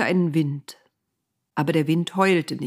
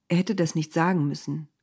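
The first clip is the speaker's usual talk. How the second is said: ordinary delivery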